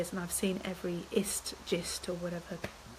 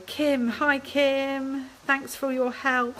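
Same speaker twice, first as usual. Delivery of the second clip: hoarse, strained voice from a virus; losing voice; 6 semitones higher, 7 dB louder, much slower than usual. Real, not acted. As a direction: voice giving out, hoarse and worn. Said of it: "Sorry, I'm losing my voice now".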